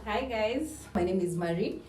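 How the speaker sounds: ordinary delivery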